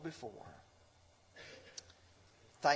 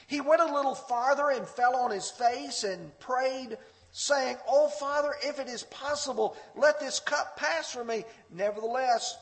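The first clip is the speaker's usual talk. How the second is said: raised, higher preaching voice, pleading; fervent preaching; 9 semitones higher, 8 dB louder than usual. Acted. Preacher voicing Jesus praying in the garden, fervent raised plea. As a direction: cry out the prayer from the pulpit.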